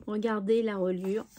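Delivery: ordinary talk, nothing marked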